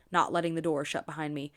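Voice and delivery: ordinary delivery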